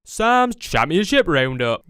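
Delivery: loud slow jingle voice; announcer voice. Pretend. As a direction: belt it like a show title card. Repeated as jingle.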